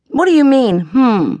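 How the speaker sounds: high, loud, indignant challenge; Stella-Rondo challenging her sister at the table; sharp retort. A narrator's reading. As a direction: snap back at your sister.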